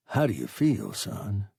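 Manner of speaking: plain reading voice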